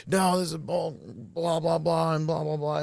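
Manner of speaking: high, dismissive blah-blah; whiny brushing off. Retold or imitated. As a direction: brush it all off petulantly. Retold defiant excuses.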